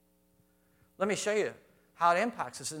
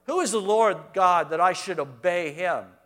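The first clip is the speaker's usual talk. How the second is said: loud, higher contemptuous taunt; scornful Pharaoh; 5 semitones higher, 6 dB louder than usual. Retold or imitated. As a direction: look down on the slaves and mock. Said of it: "The world says that".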